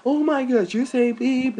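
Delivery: mock TV argument. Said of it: "you always hear, like".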